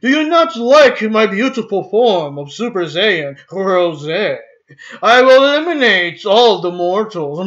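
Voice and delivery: put-on theatrical British accent; mock British villain. Transformed villain showing off his form, mock British accent. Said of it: "he's, like, British now".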